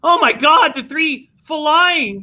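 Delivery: rising thrill as the three flies; excited race call. Real, not acted. Longshot closes in race call.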